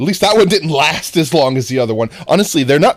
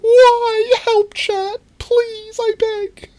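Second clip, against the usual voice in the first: much higher, laughing fake sobs; mock crying plea; slower than usual. Streamer begging chat to stop the T-pose, laughing mock wail. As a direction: beg chat for help through giggles.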